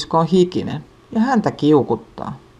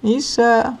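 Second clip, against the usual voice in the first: calling out; 8 semitones higher than usual. A narrator's reading. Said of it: "pikkusisko huutaa".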